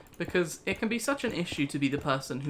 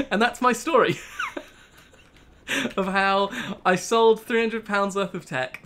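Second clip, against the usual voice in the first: loud, raised voice broken by laughter; laughing; 4 semitones higher, 7 dB louder than usual. Real, not acted. Streamer telling viewers of a bad eBay sale, laughing loudly. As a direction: laugh your way through it.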